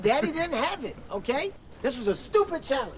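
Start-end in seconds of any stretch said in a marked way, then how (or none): none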